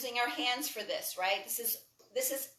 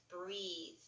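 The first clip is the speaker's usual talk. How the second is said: soft cue; 5 semitones lower, 12 dB quieter than usual. Acted. Guiding breath in yoga class.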